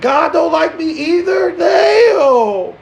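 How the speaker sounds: anguished shout. Real, not acted. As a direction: wail it out in mock despair. Outburst at God disliking him.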